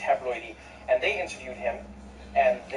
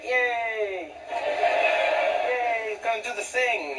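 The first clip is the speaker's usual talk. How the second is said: high-pitched sarcastic yay; hammed-up happy cheer; mock cheering; 7 semitones higher, much slower than usual. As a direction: fake delight at a raised pitch. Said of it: "we're never like".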